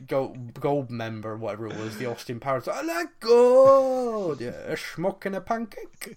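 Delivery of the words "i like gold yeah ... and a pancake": put-on high, slow, stupid Dutch accent; a hammed-up stupid Dutch accent, much higher; Goldmember impression. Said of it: "a stupid Dutch accent".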